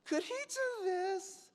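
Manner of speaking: very high, slow squeaky plea; mock plea. Actor begging the director about a partner, tiny high-pitched voice. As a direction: squeak it out, drawn out.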